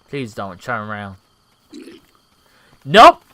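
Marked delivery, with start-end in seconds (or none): 2.8-3.2 loud exclamation. Real, not acted. Enemy turns around, sneak fails.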